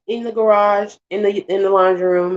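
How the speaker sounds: ordinary delivery, nothing marked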